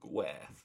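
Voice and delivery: "Gwerth" said softly like "weth"; chosen Welsh pronunciation. Shown as a demonstration. Film fan settling on a Welsh title, soft tentative pronunciation. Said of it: "is how I'm choosing to say it".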